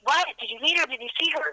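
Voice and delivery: high-pitched, eager imitation of quick questions; excited quote, pitched well up; retold excitement. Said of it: "then Peter was excited".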